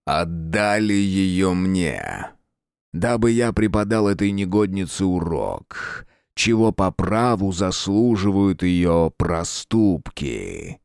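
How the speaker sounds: slow menacing villain rumble; dark lord. Acted.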